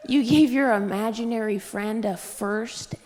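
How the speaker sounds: ordinary talk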